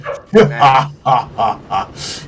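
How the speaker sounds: slow, ominous godlike laugh; deliberate villainous ha-ha from the sky; laughter from above. Acted. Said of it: "you hear the laughter from above".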